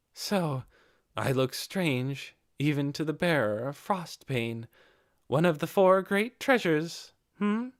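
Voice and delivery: a wry dig at her own strangeness; cynical young chief. A narrator's reading.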